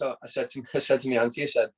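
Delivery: normal talking voice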